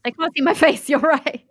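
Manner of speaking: high, laughing through the words. Real, not acted. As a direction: giggle out the agreement. Laughing agreement.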